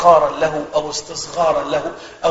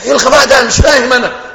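impatient mock yell, higher and louder; angry teacher; 7 semitones higher, 7 dB louder, faster than usual. Teacher berating the student in theology class, exasperated yell. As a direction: imitate a teacher losing patience.

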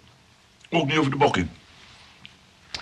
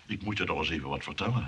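usual speaking voice

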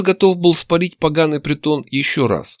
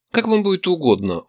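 higher-pitched, fawning waiter; obsequious; 7 semitones higher than usual. A narrator's reading. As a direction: oblige the customer servilely. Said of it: "молвил халдей угодливо". (угодливо)

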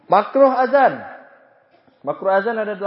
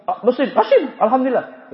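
mock sneezer; 6 semitones higher, 4 dB louder than usual. Acting out a sneezer.

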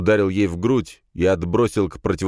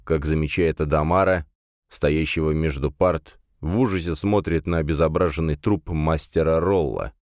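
plain reading voice

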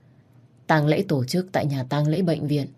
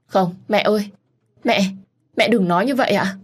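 higher and louder, crying out in grief; anguished cry; 6 semitones higher, 4 dB louder than usual. Acted. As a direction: cry out no to mother. Daughter cries out at dying mother. (kêu lên đau đớn, cao và to)

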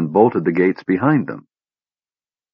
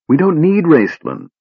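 much higher, bursting in; eager interruption; 10 semitones higher, 3 dB louder than usual. A narrator's reading. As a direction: jump in eagerly over her. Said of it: "Tas interrupted".

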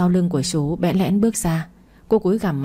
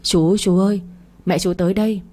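ordinary delivery

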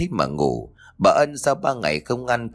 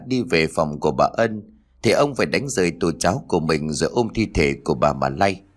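ordinary delivery

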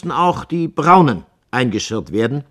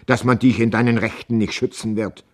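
plain reading voice

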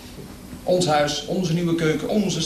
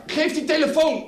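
shouting; 9 semitones higher than usual. Acted. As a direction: yell at him to hand it over. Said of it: "riep ik". (schreeuwend de telefoon opeisen)

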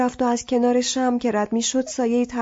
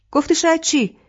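higher, louder sharp challenge; snapping back louder at Shabaneh's maybe; angry retort; 6 semitones higher, 5 dB louder than usual. Acted. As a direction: demand an answer. Arsalan snaps at her maybe.

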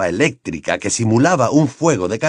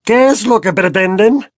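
loud, high, outraged question; indignant raised voice; bellowed demand; 11 semitones higher, 5 dB louder than usual. A narrator's reading. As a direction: bellow it at the intruders. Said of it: "bramó en dirección a la chimenea".

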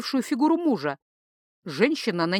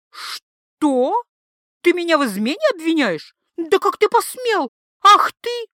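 much higher, louder, rising to a shriek; outraged wife explodes at husband; 9 semitones higher, 6 dB louder than usual. A narrator's reading. (возмущённый крик жены)

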